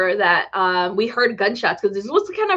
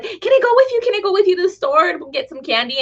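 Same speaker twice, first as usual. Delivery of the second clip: put-on high child's pleading; 9 semitones higher, faster than usual. Child begging uncle for candy, high pleading. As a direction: beg like a little kid. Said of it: "and I'm like".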